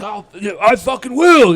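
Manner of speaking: imitating an angry customer, high and very loud; exaggerated loud impression of a sore loser; mock defiant customer. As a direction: blurt it as a hammed-up defiant comeback. Mimicking customer's defiant exit.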